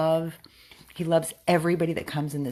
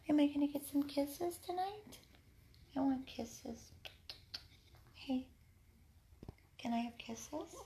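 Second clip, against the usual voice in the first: tiny, soft cooing voice; high-pitched dog talk; 10 semitones higher, 14 dB quieter than usual. Real, not acted. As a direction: plead very softly and high with a pet. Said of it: "He loves when I talk all high pitch".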